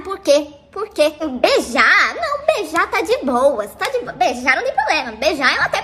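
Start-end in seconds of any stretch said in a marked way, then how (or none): none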